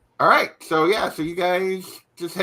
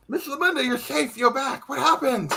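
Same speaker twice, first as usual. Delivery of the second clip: higher, eager townsfolk voice; curious crowd; 10 semitones higher than usual. Acted. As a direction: crowd pressing in with questions. Crowd gathers around her.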